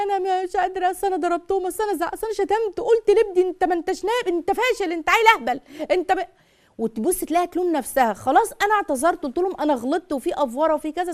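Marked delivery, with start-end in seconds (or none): 0.0-6.3 higher pitched, lightly acted mother confessing she hit and insulted her kids; a mild mock of a mother blaming herself, pitched up; imitating a guilt-ridden mother. Guilty mother retelling her outburst to the advisor, mock distressed and higher. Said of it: "تيجي تقول لك ايه".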